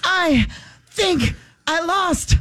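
high, slow, drawn-out imitation; mock agonized stop-and-start delivery; gasped-out words. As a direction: squeeze out each word between wails. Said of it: "Finally, in between, he's going".

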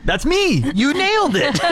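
laughing. Real, not acted. Laughing agreement.

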